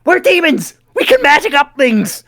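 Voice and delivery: high, loud, hammed-up outburst; mock exasperated shout. Demon snapping at a colleague, loud comic exasperation. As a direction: yell it with laughter breaking in.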